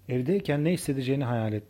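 usual speaking voice

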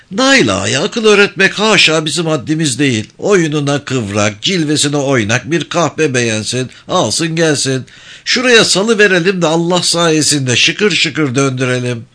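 high, sly village elder; holding court with mock gravity; sly old man speech. Topal İsmail sly mockery. (tiz sesli, sinsi yaşlı ağa konuşması)

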